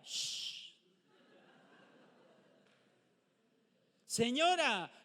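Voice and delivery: high hushing call to the woman; mocking a passer-by shushing the injured woman. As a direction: hush her impatiently, hammed-up. Mock hushing screaming woman.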